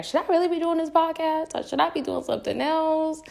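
high, wondering inner voice on "should I really"; questioning yourself aloud, light and high; self-doubting question. Retold or imitated. Quoting her own self-doubt.

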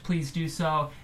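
ordinary talk

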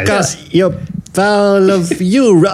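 put-on British accent. Riffing as a British character.

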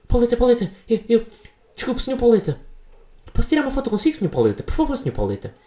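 high, gushing fanboy begging for a photo; hammed-up star-struck fan voice; fawning fanboy. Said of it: "eu não disse assim".

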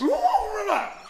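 crying. Acted. Crying back at friend.

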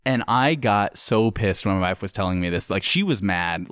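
normal talking voice

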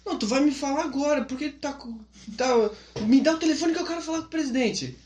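high, insistent demand for the phone; demanding missionary. Retold or imitated. Missionary demanding the phone from his secretary, high and insistent. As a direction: push hard, he wants the president.

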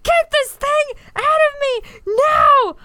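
high, loud, slowed shouted demand; mock labour scream. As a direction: scream to get the baby out.

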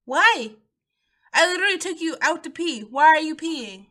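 high, loud mock exasperation; exasperated. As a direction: plead loudly with a misbehaving dog. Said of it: "I'm just like".

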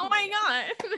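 high laughing gush; laughing squeal. Real, not acted. Gushing over cute dad.

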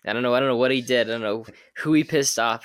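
normal talking voice

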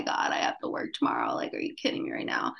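fast, breathless whisper to herself; exasperated whisper. Retold or imitated. Tired mom whispering to herself when the kids wake at night. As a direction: hiss it so the kids can't hear, fed up.

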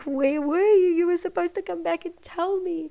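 high, tearful mock wail; retold tearful outburst. New mother to husband who didn't come back, mock tearful. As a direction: imitate a crying complaint, pitched well up. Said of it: "I just burst out crying".

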